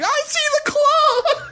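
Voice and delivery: very high, drawn-out scream; hammed-up squeal of terror; freaked-out shriek. Girlfriend going from zero to sixty, freaked-out shriek. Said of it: "went from zero to sixty".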